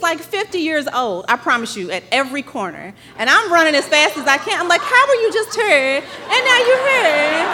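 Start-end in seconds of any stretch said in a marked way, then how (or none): 4.8-7.5 high, laughing disbelief at her dad; amazed disbelief. Retold or imitated. Runner baffled her dad keeps reappearing, laughing disbelief. Dad appears at every corner.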